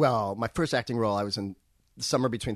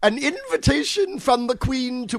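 high, slow posh British recital; proper little British footman line, slow; British accent; 12 semitones higher, 6 dB louder, slower than usual. Retold or imitated. Child actor reciting his old line in British accent. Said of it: "Were you using an accent like that?".

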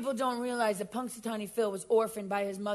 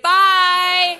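loud abrupt goodbye; 6 semitones higher, 12 dB louder than usual. Acted. Dismissive sign-off.

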